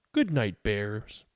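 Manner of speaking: ordinary delivery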